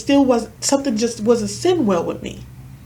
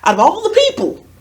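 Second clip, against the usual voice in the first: reliving disbelief, high and loud; retold shock; 9 semitones higher, 5 dB louder, faster than usual. Friend retelling news of Josh's death, shocked. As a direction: let the pitch leap in incredulous shock. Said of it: "it was shocking 'cause I'm like".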